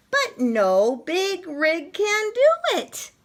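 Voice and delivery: plain reading voice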